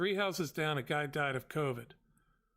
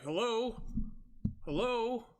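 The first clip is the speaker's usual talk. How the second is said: high, questioning hello, repeated after a beat; exasperated; 7 semitones higher, slower than usual. Retold or imitated. Customer to driver who doubts his own porch, exasperated. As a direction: raise the pitch as if checking anyone is listening.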